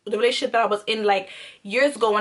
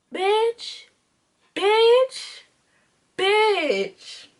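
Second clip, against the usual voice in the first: high-pitched, drawn-out mock outrage; retold outraged confrontation; 8 semitones higher, much slower than usual. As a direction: call it out high, one word at a time. Confronting figures in the bed.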